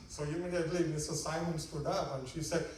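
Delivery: ordinary talk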